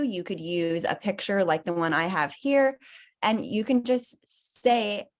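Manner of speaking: ordinary talk, nothing marked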